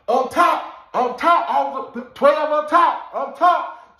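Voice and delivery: loud high call across the tier; inmates calling out. Retold or imitated. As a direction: shout the warning up the stairs. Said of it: "you know what everybody say".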